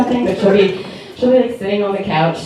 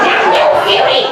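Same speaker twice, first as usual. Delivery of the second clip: mom's frustrated no; 6 dB louder than usual. Retold or imitated. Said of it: "she's like".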